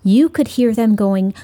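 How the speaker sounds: ordinary delivery, nothing marked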